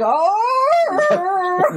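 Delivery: high, long hooting creature call; bigfoot call. Retold or imitated. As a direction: hoot like Bigfoot calling out. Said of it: "like, a Bigfoot call".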